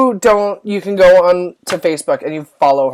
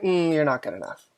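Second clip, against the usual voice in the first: soft, much quieter nagging voice; inner critic voice; 3 semitones lower, 10 dB quieter than usual. Retold or imitated. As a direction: murmur it like a doubt in her head. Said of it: "that little voice in there".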